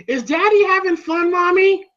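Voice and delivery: put-on high-pitched child's question; little boy voice. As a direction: imitate a small son asking his mother. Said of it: "Rocky's son's looking at Adrian".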